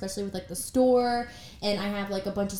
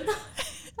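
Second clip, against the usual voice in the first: laughing; 12 semitones higher, 10 dB quieter than usual. Real, not acted. Both crack up over crying faces.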